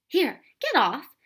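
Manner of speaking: jolted by surprise; startled. A narrator's reading. Tom startled by the bird, a startled yelp. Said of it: "in a startled voice".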